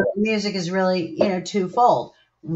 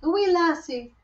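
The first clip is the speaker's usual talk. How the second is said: a lightly put-on Irish lilt, higher; playful leprechaun voice, pitched up; 10 semitones higher than usual. Repeats it in a put-on voice.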